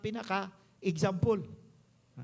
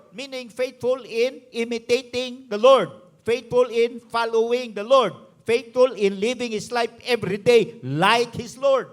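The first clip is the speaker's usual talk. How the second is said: preaching with force; 9 dB louder than usual. Acted. Preacher building emphatic list.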